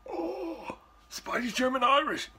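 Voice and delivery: high mock gasp of surprise; playful fake astonishment. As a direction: feign shock at the news. Mock reaction to own ancestry.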